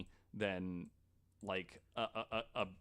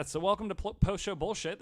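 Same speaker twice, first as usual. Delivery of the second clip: loud, high podcast host opening; show intro; 10 semitones higher, 7 dB louder than usual. Acted. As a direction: announce the show title with a big hype.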